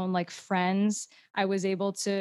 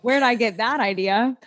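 normal talking voice

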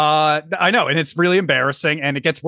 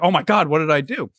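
ordinary talk, nothing marked

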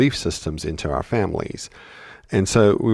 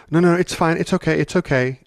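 freaked out; 10 semitones higher than usual. Retold or imitated. Mother imitating a flustered adult brushing off a child's apology, much higher. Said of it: "gets really, really freaked out and goes".

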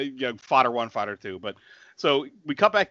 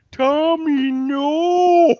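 high, loud, stretched-out yell; slow-motion scream; 8 dB louder, much slower than usual. Retold or imitated.

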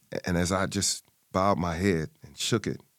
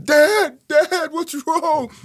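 high, loud, slow tearful plea; high slow crying call; 8 dB louder, much slower than usual. Retold or imitated. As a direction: cry out to a father in panic. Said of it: "I started crying".

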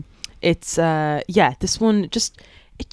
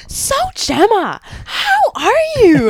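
high, loud, drawn-out swooping greeting; radio interviewer; 6 dB louder, slower than usual. Retold or imitated. Guest mimicking her radio interviewer's greeting, gushing singsong intonation. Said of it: "it's just all about the intonation".

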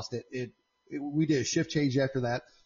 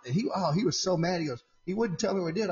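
ordinary delivery

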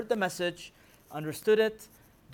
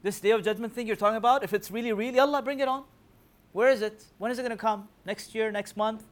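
mocking taunt; 4 semitones higher, 6 dB louder than usual. Voicing mocking disbelievers' taunt.

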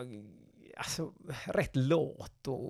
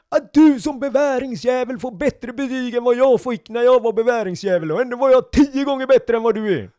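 high, loud furious officer tirade; ranting captain; 11 semitones higher, 13 dB louder than usual. Retold or imitated. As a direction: tear into the recruit at full volume.

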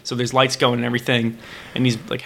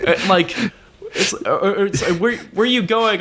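laughing; 5 semitones higher than usual. Retold or imitated. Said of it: "I'm like".

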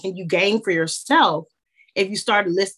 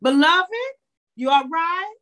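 higher, doting grandmother; grandma Hazel voice; 7 semitones higher than usual. Retold or imitated. Grandmother checking on her grandchild, higher doting voice. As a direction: call them baby and beloved like a fussing grandma. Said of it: "And she used to always say".

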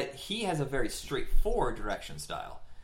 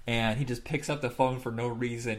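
normal talking voice

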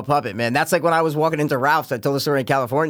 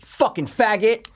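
slow, pitched-up imitated insult; retold heckle; 7 semitones higher, slower than usual. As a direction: imitate a passer-by's sudden jibe without shouting. Retells stranger's passing insult.

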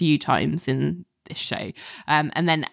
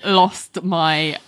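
ordinary delivery

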